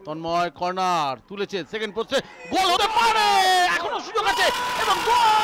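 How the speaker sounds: high, loud yelled goal call. Real, not acted. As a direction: roar out the goal. Corner leads to goal.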